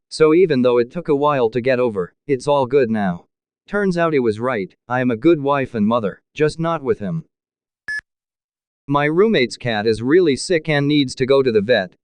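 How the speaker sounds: ordinary delivery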